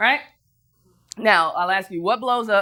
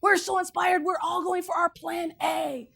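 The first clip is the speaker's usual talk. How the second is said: lightly voiced excited women, higher; inspired women; 8 semitones higher, 4 dB quieter, slower than usual. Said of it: "they say".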